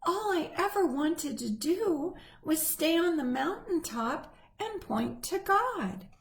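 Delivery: a small tree mourning its dream; wistful tree. A narrator's reading.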